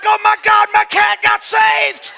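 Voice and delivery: loud, high, drawn-out hollering; over-the-top jubilant yell; ecstatic shout. Retold or imitated. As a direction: shout it in hammed-up amazement. Acts out amazement at cat's meow.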